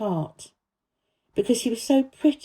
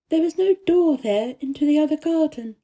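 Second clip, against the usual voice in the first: put-on lightly higher girl's voice, earnest; a girl telling the gardener about the secret garden, higher voiced; young Mary voice; 8 semitones higher than usual. A narrator's reading. Mary's voice in the story.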